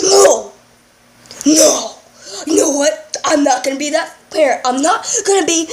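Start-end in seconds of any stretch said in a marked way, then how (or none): 0.0-1.9 sharp loud no. Acted. Dad catches himself.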